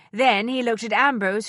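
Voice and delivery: ordinary delivery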